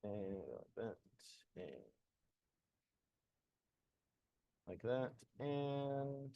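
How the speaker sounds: very soft, low murmur; barely audible self-talk; muttering to self. Real, not acted. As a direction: murmur absently while busy with a task. Muttering to self while working on screen.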